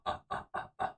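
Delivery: short voiceless ape hoots; monkey grunts. Acted. Streamer mocking people who want to be animals, monkey grunts. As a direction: huff like a monkey, in quick separate bursts. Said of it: "monkeys and shit now".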